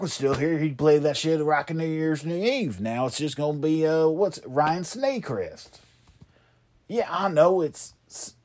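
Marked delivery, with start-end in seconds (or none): 4.5-5.8 "Snaycrest" spoken slowly, "Snay" instead of "Sea". Shown as a demonstration. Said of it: "Snaycrest is how I'm gonna snay it".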